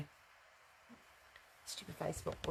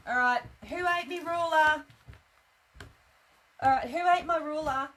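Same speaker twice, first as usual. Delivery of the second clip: loud, high call for the ruler; yelling across the room; 7 semitones higher, 7 dB louder than usual. Real, not acted. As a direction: shout it out to the whole room. Calling out for lost ruler.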